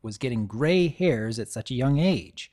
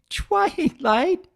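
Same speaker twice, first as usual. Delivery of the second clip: giggly shriek; 4 dB louder than usual. Acted. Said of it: "choked between giggles and hiccups".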